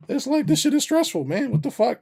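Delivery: high, loud frustrated yell; exasperated outburst. Real, not acted. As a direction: blurt it out fed up. Exasperated outburst about stress.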